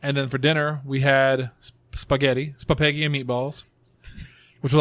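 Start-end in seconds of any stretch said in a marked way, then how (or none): none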